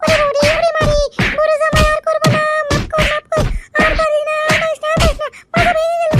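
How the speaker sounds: loud wailing plea for mercy; pained pleading. Acted. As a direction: howl and beg under the blows. Wife begs during beating.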